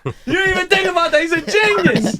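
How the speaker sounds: high gleeful shout with laughter; excited shout. Real, not acted. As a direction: crow it out, laughing. Host gloating, shouting it out.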